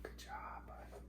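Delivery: hushed whisper, barely any voice. Real, not acted.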